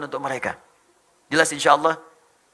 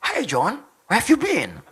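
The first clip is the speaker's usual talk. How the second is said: English greeting with lifted, swooping intonation; English speaker impression; 5 semitones higher, slower than usual. Shown as a demonstration. Showing the crowd Indonesians can sound English, mimicked English accent. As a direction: put on a native English accent. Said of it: "kayak orang Inggris".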